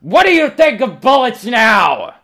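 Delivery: very high, loud and slow; high drawn-out manic shriek; shrieking Examiner. Acted. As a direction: screech the taunt. Examiner taunts bleeding Marine.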